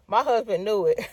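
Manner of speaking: ordinary talk, nothing marked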